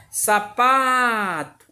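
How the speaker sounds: "Sapato" chanted with a long, stressed PA; singsong stretched syllable. Shown as a demonstration. Teacher repeating the word for students, singsong "Sapato" with stretched PA. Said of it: "qual foi a sílaba que eu falei mais forte?".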